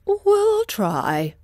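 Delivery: high slow bird voice; a reluctant big bird; stork. Acted. Stork agrees to help Dorothy, high and slow.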